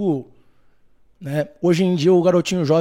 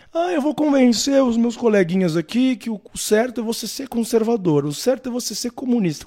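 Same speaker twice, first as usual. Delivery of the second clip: mocking student; 6 semitones higher than usual.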